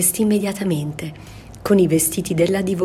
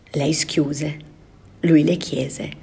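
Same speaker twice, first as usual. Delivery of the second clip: usual speaking voice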